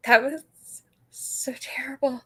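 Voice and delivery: breathy whisper. Real, not acted. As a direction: drop to a whisper. Whispered reaction to the vote.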